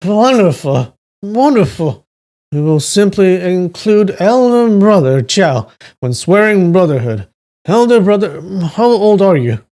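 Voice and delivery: put-on high, gleeful drunken voice; elated drunk. A narrator's reading. Drunk man agreeing to swear brotherhood, elated. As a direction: gush with tipsy delight. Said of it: "Duan Yu was elated".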